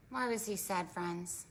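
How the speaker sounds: ordinary delivery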